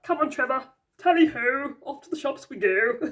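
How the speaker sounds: cheery put-on voice for the knight on the card; jolly knight voice. Voicing the Knight of Cups off shopping, jolly put-on voice. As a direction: ham up a jaunty rider setting off. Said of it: "he's like".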